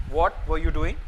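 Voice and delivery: normal talking voice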